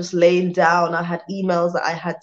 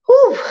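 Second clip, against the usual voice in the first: high whew; 7 dB louder than usual. Real, not acted. Exhausted vlogger imagines burning out.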